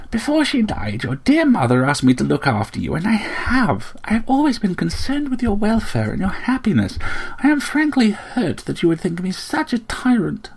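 high, restrained guilt-trip; wounded, reproachful aunt. A narrator's reading. Aunt invokes the dead mother to her nephew, wounded reproach.

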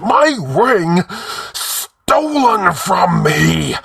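high, loud drawn-out shriek. A narrator's reading. The slave claims his stolen ring, shrieking. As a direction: cry it out, outraged and slow. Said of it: "he shrieked".

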